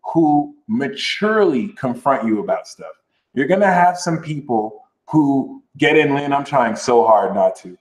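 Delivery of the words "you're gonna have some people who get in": usual speaking voice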